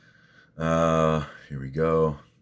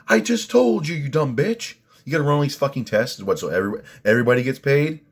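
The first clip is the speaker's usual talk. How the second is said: higher, louder, outraged; furious high rant; 10 semitones higher, 5 dB louder than usual. Real, not acted. Venting to viewers about the doctor, furious rant. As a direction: yell it at the absent doctor.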